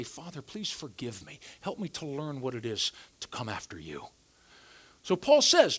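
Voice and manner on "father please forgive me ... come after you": low, much softer plea for forgiveness; hushed prayer. Retold or imitated. Preacher voicing his own prayer to God, hushed and soft. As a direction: murmur it like a humble confession.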